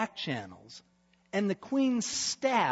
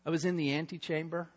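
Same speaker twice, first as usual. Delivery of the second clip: ordinary delivery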